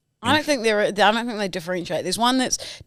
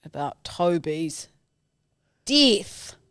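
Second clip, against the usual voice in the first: melodramatic; 3 semitones lower, much slower than usual. Acted. Dramatic solemn line.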